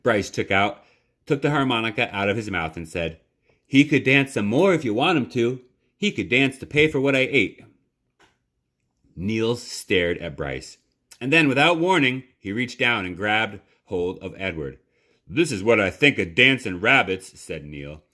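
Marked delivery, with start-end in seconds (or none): 3.7-7.6 higher and louder, pleading offer; eager boy. Acted. Bryce offers the rabbit's dance as payment, eager and pleading. 15.3-17.4 a touch of a hard, scornful voice; mild contempt. Acted. Neil scorns the dancing rabbit, mildly hard. Cook about to smash rabbit.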